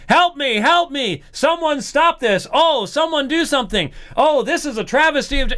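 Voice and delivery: mock pleading. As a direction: cry for help as a mocking imitation. Mock plea Jesus never made.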